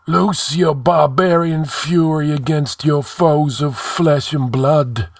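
high, ghostly ancient answering; sepulchral. A narrator's reading. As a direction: intone it like a long-dead sage. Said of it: "spoke in sepulchral tones".

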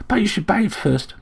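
chirping sparrow. A narrator's reading. Sparrow fusses over Slayle's injuries, chirping. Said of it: "chirped the sparrow".

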